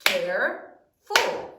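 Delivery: "Careful" drawn out slowly into two beats; slow syllable split. Shown as a demonstration. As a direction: stretch "Careful" so each syllable is heard. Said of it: "How many syllables are there in careful?".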